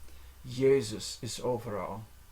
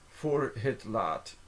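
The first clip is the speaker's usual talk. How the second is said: slowed down, each word separated; slow careful repeat; slower than usual. Shown as a demonstration. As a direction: say it slowly for a learner. Phrase repeated slowly, word by word.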